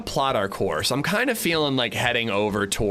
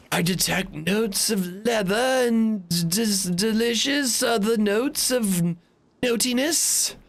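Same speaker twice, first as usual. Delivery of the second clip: high, slow mock beer-snob voice; pretentious beer connoisseur; 10 semitones higher, slower than usual. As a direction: put on a slow, high-pitched snooty taster. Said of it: "I'm not the, like".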